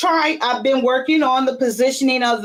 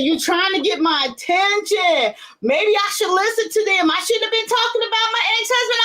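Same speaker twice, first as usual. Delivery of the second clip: retold plea to the Lord; 8 semitones higher than usual. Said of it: "I said".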